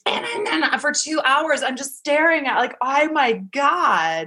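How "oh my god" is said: slow, drawn-out amazement, pitched up; awestruck. Retold or imitated. Staring at the baby for two hours, awestruck. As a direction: stretch every word in wonder.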